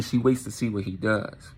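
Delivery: usual speaking voice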